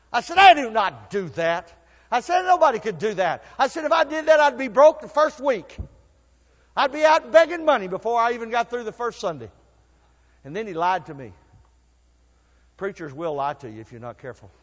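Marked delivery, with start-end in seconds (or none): 0.4-9.5 high, loud disbelieving protest; louder, higher outraged refusal; incredulous protest. Retold or imitated. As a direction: push back like it's absurd.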